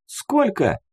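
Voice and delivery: put-on high-pitched girl's query; voiced girl's short question; teenage girl asking. A narrator's reading. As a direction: ask it high, as a curious teen girl. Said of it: "поинтересовалась Рыся".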